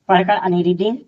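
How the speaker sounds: ordinary delivery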